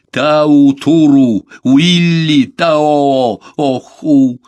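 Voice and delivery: loud, high, slow proclamation; resolute. A narrator's reading. Беккер asks for help while rapping his rifle, resolute. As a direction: stretch out each word with full resolve. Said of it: "проговорил Беккер решительно".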